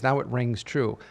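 ordinary talk, nothing marked

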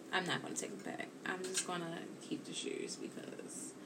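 hushed whisper to herself; whispered aside. Real, not acted. Mother resigning herself to keeping the shoes, whispered. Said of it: "whispered aside".

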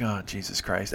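low, quiet groaned aside; deflated, soft retold curse; private exasperation. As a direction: sigh it to yourself.

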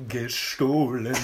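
very slow, drawn-out film quote; movie hotelier. Retold or imitated. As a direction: stretch the word out as the gloating hotelier. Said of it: "den Hotelier, der so". (genüsslich gedehnter Hotelier aus dem Film)

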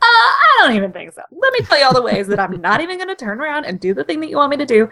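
high-pitched, rushed mock protest; hammed-up, quick-talking indignant imitation; mock Rodimus refusal. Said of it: "Rod being Rodimus and being like".

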